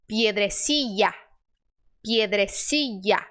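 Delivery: "piedrecilla" with LL as a Y glide; I sound for LL. Shown as a demonstration. Teacher showing the first option, Y-glide "piedrecilla". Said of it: "é o som I".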